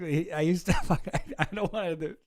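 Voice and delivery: ordinary talk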